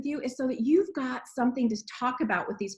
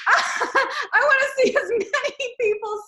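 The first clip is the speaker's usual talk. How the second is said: laughing through the words, higher and louder; 11 semitones higher, 6 dB louder, slower than usual. Real, not acted. Host laughing at a thrown dog toy, giggling high and loud. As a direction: let the giggle carry the line.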